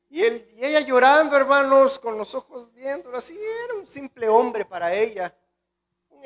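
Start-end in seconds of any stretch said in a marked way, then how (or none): 0.1-4.3 preaching swell. Acted. Preacher swells telling of weeping María.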